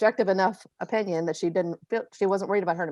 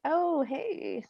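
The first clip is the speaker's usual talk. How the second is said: shocked family greeting; 10 semitones higher, much slower than usual. Retold or imitated. In-laws react to dyed hair.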